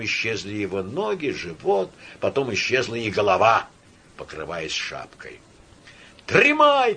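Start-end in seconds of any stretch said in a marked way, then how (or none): 6.2-6.9 high, loud, stretched cry; thin tenor shout. A narrator's reading. Second man answering the first, thin tenor shouting. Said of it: "кричал в ответ первому второй тонкий тенор".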